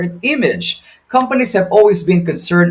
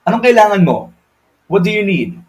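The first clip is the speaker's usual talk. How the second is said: blunt, unwelcoming phone answer; curt, unfriendly greeting; 5 semitones higher, 4 dB louder, faster than usual. Shown as a demonstration. Agent demonstrates a rude phone greeting, curt and cold. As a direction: snap it out like you don't want the call. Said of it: "That doesn't sound right".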